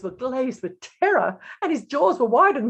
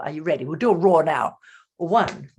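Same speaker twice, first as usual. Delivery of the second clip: usual speaking voice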